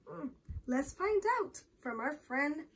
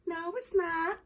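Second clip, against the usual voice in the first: put-on higher-pitched child's contradiction; defiant retort; 5 semitones higher than usual. A narrator's reading. Julie talks back to Michael about the backpack, defiant retort. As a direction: answer back pertly.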